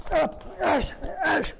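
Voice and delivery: sharp high kiai grunts on each strike; kiai shouts. Acted. Instructor shouting with each strike in the drill, sharp high kiai. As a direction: bark it out with each hit.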